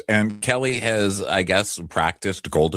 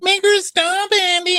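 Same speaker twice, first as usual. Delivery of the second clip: very high, louder falsetto; shrill plea to Andy; whiny mock plea; 5 dB louder than usual. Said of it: "Kelly's like".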